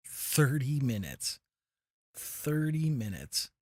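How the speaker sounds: THIRTY drawn out, high and loud, falling on minutes; slow, loud, self-reproachful stress on THIRTY; exasperated emphasis. Real, not acted. Berating self for streaming idle screen.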